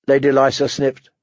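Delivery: ordinary delivery, nothing marked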